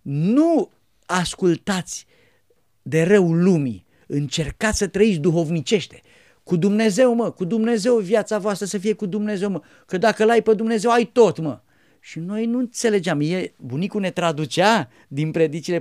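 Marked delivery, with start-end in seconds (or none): 0.0-11.6 put-on old monk booming, higher and louder; loud preaching.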